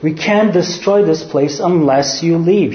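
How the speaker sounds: normal talking voice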